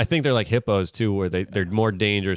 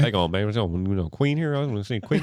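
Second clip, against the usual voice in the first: put-on lightly done Southern Ohio accent. Luke doing Southern Ohio for his friends, a light Southern Ohio accent. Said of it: "[name] doing Southern Ohio".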